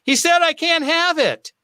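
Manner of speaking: high-pitched, whiny child imitation; mimicked little kid, voice way up; kid complaining to dad. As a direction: tattle to Dad like a let-down kid. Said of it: "he turns to his dad".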